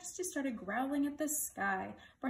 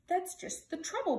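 higher-pitched royal outburst; mild story bellow; 8 semitones higher, 3 dB louder than usual. A narrator's reading. King answering his page boy, lightly bellowing. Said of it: "bellowed the king".

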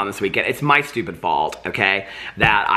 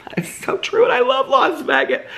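airy mock agreement; 13 semitones higher than usual. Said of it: "I was like".

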